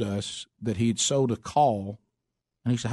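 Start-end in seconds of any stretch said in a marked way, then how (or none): none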